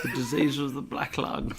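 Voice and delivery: lightly put-on character telling of an illness; a hint of an invented voice, laughter creeping in; unidentified character voice. Host capping his mock consumption tale, lightly put-on character voice. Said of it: "Not sure who this voice is either".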